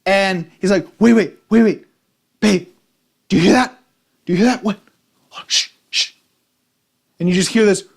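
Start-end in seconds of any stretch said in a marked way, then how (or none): 1.0-4.8 urgent alarm. Retold or imitated. Guy in a hotel bed alerting his girlfriend, urgent mock alarm. Guy hears noise through wall. 5.3-6.1 whispered shushing; hushing whisper. Retold or imitated. Guy shushing his girlfriend to hear the wall, whispered hush.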